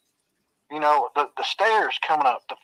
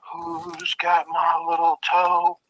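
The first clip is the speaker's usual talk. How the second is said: slow, drawn-out eerie moan; haunt the line like a ghost hunting its toe; ghostly voice; 2 semitones higher, much slower than usual. Retold or imitated. Dead man searching the house for his lost toe, slow ghostly moan. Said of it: "I hear it clear".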